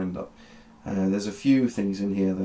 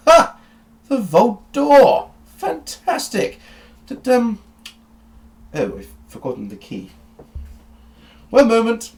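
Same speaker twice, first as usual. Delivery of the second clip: high, loud, gleeful professor; excited eccentric doctor; 10 dB louder, slower than usual. Acted. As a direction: burst out with giddy delight. GM voices excited Doctor Beige.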